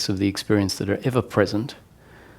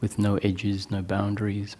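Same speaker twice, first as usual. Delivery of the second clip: soft meditation voice with long pauses; hushed, unhurried meditation leading, sinking softer at phrase ends; guided meditation; 5 dB quieter than usual. Real, not acted. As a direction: guide them gently inward, leaving long silences. Leads eyes-closed guided meditation.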